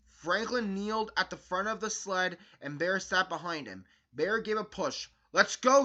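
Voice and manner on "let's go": higher, louder shout. A narrator's reading. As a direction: call it out with a push of glee. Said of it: "he shouted".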